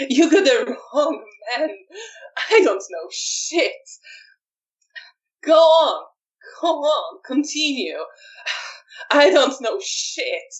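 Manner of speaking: mock the interrogator as if losing it; unhinged taunting. Beaten prisoner taunting Edwin, high and manic. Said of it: "Arek just continues to laugh and says".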